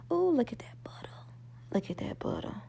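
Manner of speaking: breathy awed whisper. Real, not acted. Cook admiring her buttered toast in a whisper. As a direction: hush it like a delicious secret.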